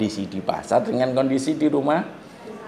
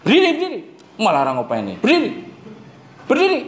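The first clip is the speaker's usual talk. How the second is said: put-on stern order to a boy; 10 semitones higher, much faster than usual. Shown as a demonstration. Teacher shows how to order a boy to stand. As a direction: order the dozing boy up, sharp. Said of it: "Itu kalau ngomong sama anak laki-laki".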